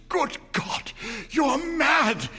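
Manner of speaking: high gasping then loud cry; horrified gasp. A narrator's reading. Husband horrified at his wife, gasping then crying out. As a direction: gasp it out in horror. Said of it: "he cried, gasped".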